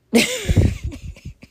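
high-pitched giggle; high laugh. Real, not acted. Signing off tired, high-pitched giggle. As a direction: let out a high squealing laugh.

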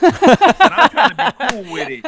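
loud laugh through the words; laughing hard. Real, not acted. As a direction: laugh while saying it. Laughing while retelling.

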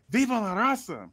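put-on a half-hearted gangster accent he admits he can't do; Eddie Guerrero impression. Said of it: "He said that was zero seasoning".

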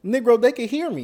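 loud protest. Real, not acted. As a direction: insist they can hear. Protests being told speak up.

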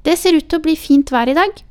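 put-on high, loud child's announcement; declaring. A narrator's reading. Child changes the subject from swimming to weather, declaring it loudly. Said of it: "og erklærte".

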